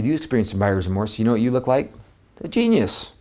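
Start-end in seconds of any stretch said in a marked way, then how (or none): 2.4-3.1 high exclaimed genius. Acted. Punchline to agents.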